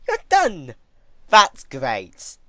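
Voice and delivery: high, loud, mock-cheerful praise; happy cheer. Twilight clapping as Celestia finishes, cheerful. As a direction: cheer like a delighted child. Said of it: "clapped her hooves happily".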